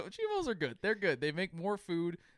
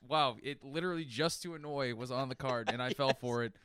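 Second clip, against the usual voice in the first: ordinary delivery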